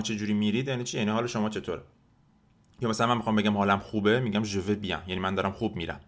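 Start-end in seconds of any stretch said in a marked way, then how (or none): none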